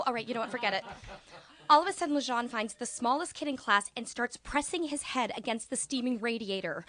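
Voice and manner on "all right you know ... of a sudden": usual speaking voice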